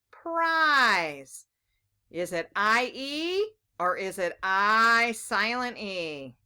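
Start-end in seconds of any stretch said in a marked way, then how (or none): none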